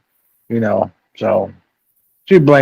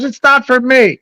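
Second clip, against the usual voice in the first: higher mock voice of a sister asking for money; mimicking sister; 13 semitones higher, 3 dB louder than usual. Teller mimics half sister begging for cash, higher mock voice. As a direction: ham up the sister's sweet ask. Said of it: "She goes".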